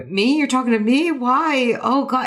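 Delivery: like being called on in class; startled. Retold or imitated. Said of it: "Emily looked so startled".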